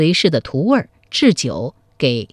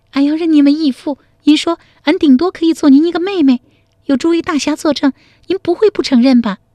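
higher, quick and teasing argument; cheeky young woman; 10 semitones higher, faster than usual. Acted. A girl holds the hero to his own words, quick and cheeky. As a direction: pin him down with a playful grin. Girl argues her case.

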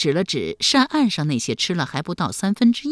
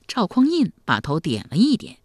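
ordinary delivery

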